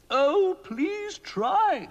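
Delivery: high, slow begging plea; a queen begging the wizard; queen pleading. A narrator's reading. Queen begging Mister Ben to try, high slow plea.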